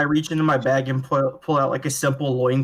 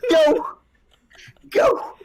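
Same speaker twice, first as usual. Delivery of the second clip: panicked high yelling. Acted.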